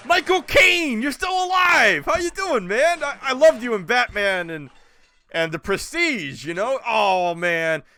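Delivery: squealing like a thrilled fan; starstruck fan. Retold or imitated. Fan meets Michael Caine, gushing in a high, loud, excited voice. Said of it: "Be like".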